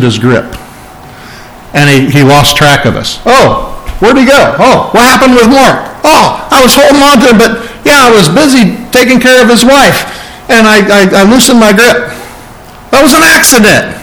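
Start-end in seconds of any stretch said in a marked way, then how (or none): 3.3-14.0 high, mock-surprised bumbling excuses; flustered excuse-maker. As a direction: play a hapless guardian caught out, pitched high. Mock God losing his grip.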